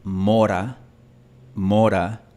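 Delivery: mora with a single tapped r; Spanish tap r in mora. Shown as a demonstration. Teacher demonstrating mora with a tapped r. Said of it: "si yo pronuncio mora".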